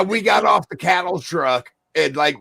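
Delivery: ordinary talk, nothing marked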